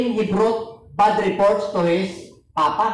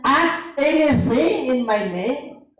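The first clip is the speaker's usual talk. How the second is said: slow, solemn scripture quote, a bit higher; quoting the Bible; 7 semitones higher, slower than usual. Retold or imitated. Preacher quoting Jesus to the congregation, slow and solemn. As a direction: read it out like holy words, unhurried. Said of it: "sabi ng Bible".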